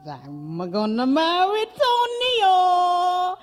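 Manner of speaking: high, loud, drawn-out announcement; thrilled girl voice, loud and stretched; excited daughter. Acted. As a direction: proudly sing out the news, very high. Daughter excitedly names her fiancé.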